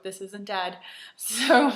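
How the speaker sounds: normal talking voice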